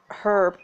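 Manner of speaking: "Herb" with the H sounded; British "herb". Shown as a demonstration. Player joking on the British rule, "Herb" with its H. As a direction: start "Herb" on a clear H. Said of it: "but Brits will say it".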